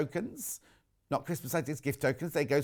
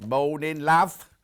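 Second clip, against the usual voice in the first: hammed-up Cockney greeting, louder at the end; Cockney accent; 5 dB louder, much slower than usual. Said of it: "Cockney accent".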